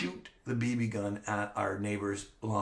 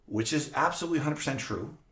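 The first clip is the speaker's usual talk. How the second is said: normal talking voice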